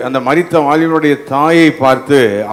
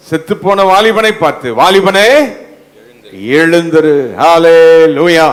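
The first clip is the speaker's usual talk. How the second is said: raised, high-energy sermon delivery; forceful preaching; 3 semitones higher than usual. Real, not acted. Preacher telling congregation of the raised dead youth, forceful proclamation. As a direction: preach it with mounting conviction, hallelujah stretched out.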